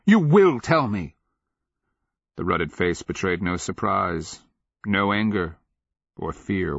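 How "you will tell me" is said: high, loud, forceful order; insistent raised voice; cried demand. A narrator's reading. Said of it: "he cried, seizing the man".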